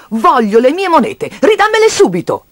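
high-pitched, insistent order; angry demand. Acted. Child shakes piggy bank for coins, angry demand. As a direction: demand it back, voice climbing high.

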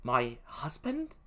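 put-on slow, hushed British-accented question; Emilia. Gentlewoman hears her husband accused, hushed in a British accent. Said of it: "please forgive my poor British accent".

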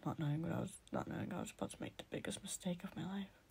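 hushed foreboding whisper. A narrator's reading. Narrator foreshadows the biggest mistake, whispering. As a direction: drop to a breathless whisper.

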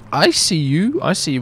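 normal talking voice

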